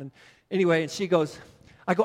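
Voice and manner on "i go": ordinary delivery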